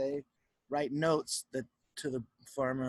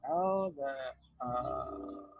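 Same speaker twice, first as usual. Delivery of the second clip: usual speaking voice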